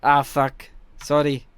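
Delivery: loud, rough imitation of a teammate's accent; Chronicle's accent, roughly. Streamer mimicking teammate after dying, loud rough accent. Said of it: "I can't do his accent fully".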